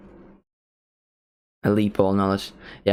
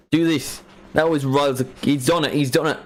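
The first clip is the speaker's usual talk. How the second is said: ordinary talk, nothing marked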